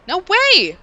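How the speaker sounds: loud high angry snap; snapping. Acted. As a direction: bark it back. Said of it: "Minorikawa snapped".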